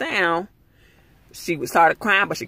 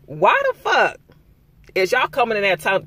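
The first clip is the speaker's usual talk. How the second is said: ordinary delivery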